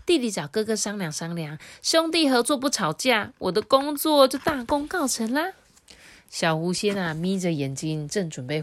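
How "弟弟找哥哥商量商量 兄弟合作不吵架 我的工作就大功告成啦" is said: pleased with itself. Acted. Fox spirit admiring its own clever plan, smug and delighted. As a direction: hum it out like a proud little trickster. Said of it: "得意极了".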